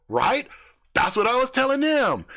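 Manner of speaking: very high, eager right; high excited yes; excited agreement. Retold or imitated. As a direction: gush in agreement. Brandon excited.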